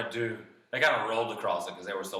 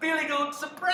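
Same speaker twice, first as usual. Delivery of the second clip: very high, excited troll squeal; gleeful surprise; 4 dB louder, faster than usual. Acted. Troll thrilled by the big goat overhead, squealing. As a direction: squeal it with greedy delight.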